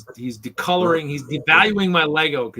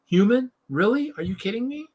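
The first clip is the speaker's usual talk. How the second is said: higher, sarcastic disbelief; mock disbelief; 7 semitones higher than usual. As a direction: scoff at the site. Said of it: "I'm like".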